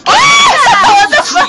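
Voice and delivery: high, loud shocked yell; startled shout. Real, not acted. Startled by game.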